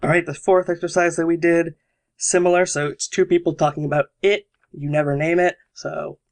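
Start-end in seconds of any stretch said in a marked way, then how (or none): none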